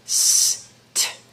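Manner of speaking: st breathed with no voice, as one blend; sounding out st. Shown as a demonstration. Teacher showing the first sound st to her class. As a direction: hiss st as a single whispered blend. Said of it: "the sound st".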